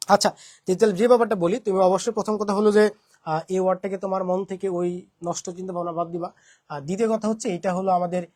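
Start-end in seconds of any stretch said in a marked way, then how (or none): none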